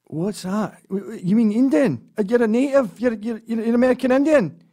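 stammering Scot amazed to meet a native; George astonished. Retold or imitated. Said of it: "And he goes".

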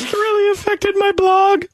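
high, put-on whiny teen; Tyler. Stepson griping about life on the farm, high mock-whining voice. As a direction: lament it high, mock-wounded. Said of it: "this is exactly how he talks".

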